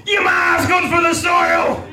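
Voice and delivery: high, slow, loud outburst; a high blurted yell of the retort; blurted shout. Retold or imitated. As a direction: yell it out without thinking. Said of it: "as loud as I possibly could".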